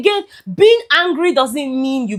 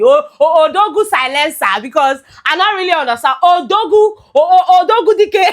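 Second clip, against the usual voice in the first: high, loud praise-name calls; playful hype hailing; 5 semitones higher, 3 dB louder than usual. Pretend. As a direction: hail a big man, breaking into laughter. Hailing Odogwu with praise names.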